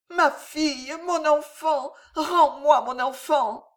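high, desperate begging voice; pleading mother. A narrator's reading. Mother begging the captor for her daughter, desperate. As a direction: beg for her child, voice pitched high. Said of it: "Elle se mit à supplier".

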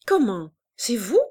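pleased surprise at the door; a touch of a sly old woman; surprised greeting. A narrator's reading. Mère Fétu surprised.

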